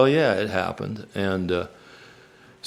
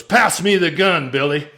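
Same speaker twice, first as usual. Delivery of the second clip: raised, clipped order to Billy; tough cowboy demand; 8 semitones higher than usual. Retold or imitated. Brother orders Billy to pass the gun, raised tough cowboy demand. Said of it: "a phrase comes into your life that you never forget".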